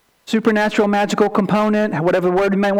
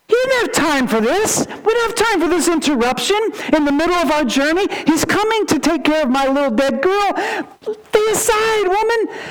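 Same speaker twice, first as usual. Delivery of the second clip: higher, brusque, hurried father brushing her off; impatient Jairus; 7 semitones higher than usual. Retold or imitated. Jairus to the woman, urgent father: higher, impatient dismissal. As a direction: shoo the woman aside, no time to waste.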